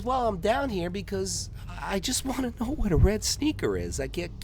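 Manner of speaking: put-on high, earnest kid voice; innocent kid. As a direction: ask it with wide-eyed innocence. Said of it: "and he says".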